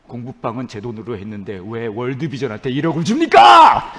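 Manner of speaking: building to a loud yell; resentful complaint. Acted. Preacher protesting to God about the money, rising resentful shout. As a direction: complain to God, exploding at the end. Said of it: "계속 하나님을 원망했죠".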